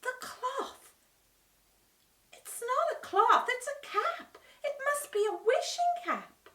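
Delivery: high, excited realisation; Dorothy gasping at the discovery; gasping surprise. A narrator's reading. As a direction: gasp as it dawns on her. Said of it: "Dorothy gasped".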